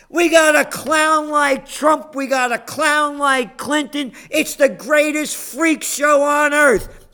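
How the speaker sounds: high, slow, loud mocking rant. Real, not acted. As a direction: belt it out like a carnival rant. Ranting about clown politicians.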